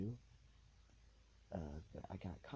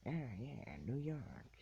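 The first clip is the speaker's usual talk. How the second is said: put-on higher-pitched old gangster-film voice; hammed-up old-movie New York talk; old-movie New Yorker; 6 semitones higher, slower than usual. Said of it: "in, like, all those old movies".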